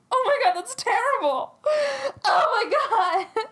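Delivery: high-pitched hammed-up wail; imitating a sobbing, horrified servant; mock crying. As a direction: wail in fake horror, dragging it out at the end. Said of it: "And he starts crying".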